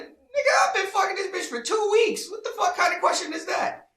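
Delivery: high, loud mock-outraged squawk; incredulous outburst. Man asked if he'll marry her, incredulous yelling. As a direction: yell it in exaggerated disbelief. Retold disbelief at marriage question.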